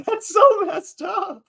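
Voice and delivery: high, slow, appalled squeal; shocked. Retold or imitated. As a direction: squeal it in disbelief. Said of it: "I'm like".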